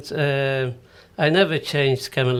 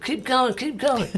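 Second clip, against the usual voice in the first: much higher, imitating eager listeners urging him on; lightly acted crowd cheering him to continue; eager encouragement; 10 semitones higher than usual. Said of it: "they said".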